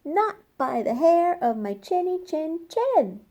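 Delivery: the little pig refusing the wolf. A narrator's reading. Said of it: "said Porter".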